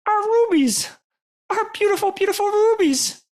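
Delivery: loud, very high creature wail; anguished squealing critter; wailing lament. Acted. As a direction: wail for your stolen treasure. Creature laments stolen gems.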